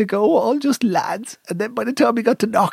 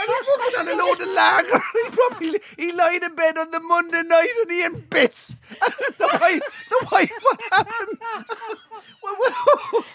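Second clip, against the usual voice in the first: high-pitched, helpless laughter through words; laughing while talking; 11 semitones higher than usual. Real, not acted. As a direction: struggle to tell it through real laughter. Teller cracks up at his story.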